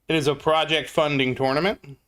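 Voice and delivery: usual speaking voice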